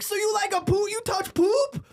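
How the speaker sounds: very high mock comic voice; imitating the comic. Comedian asks the plumber if he touches poop, very high mock voice. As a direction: squeak it up like the slangy comic.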